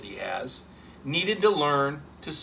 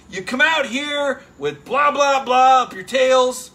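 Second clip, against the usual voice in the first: higher, louder, slow mock coach yell; Kirby Smart screaming; 6 semitones higher, 7 dB louder, slower than usual. Coach screaming at his players at practice, loud slow yell. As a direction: bark it at the players like a fired-up coach. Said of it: "he's screaming at those guys".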